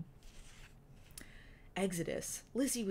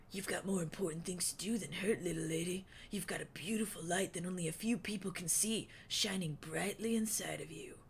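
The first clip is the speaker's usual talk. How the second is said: Wingtip's voice. A narrator's reading. Wingtip's held voice.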